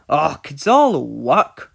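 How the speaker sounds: put-on Scottish accent, slow and loud; easygoing Scottish shrug in the voice; Scottish twin engine. Scottish twin shrugging to James, Scottish accent. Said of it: "the Scottish twins".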